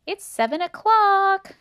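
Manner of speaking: loud, high and slow call; yelling upstairs. A narrator's reading. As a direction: yell it up the stairs. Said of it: "she yelled up the stairs".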